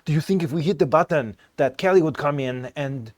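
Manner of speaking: clumsy put-on Norwegian lilt; bad Norwegian accent. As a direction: imitate a Norwegian, badly. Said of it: "that's that bad Norwegian accent".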